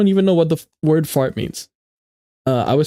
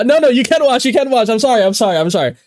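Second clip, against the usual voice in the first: high, loud, tumbling apology; flustered backpedalling; 9 semitones higher, 4 dB louder, faster than usual. Real, not acted. Streamer apologizing to a viewer in class, flustered. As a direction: rush to take it back, high-pitched and pleading.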